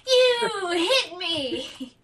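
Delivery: slow laughing whine. Real, not acted. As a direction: stretch it out through laughter. Third take, laughing crying slow.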